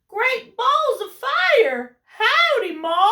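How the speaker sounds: high, loud, slow Western drawl; baby goose voice. Acted. Newly hatched goose greeting Jack, high loud holler. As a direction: holler it like a cowboy gosling. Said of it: "looked up at Jack and said".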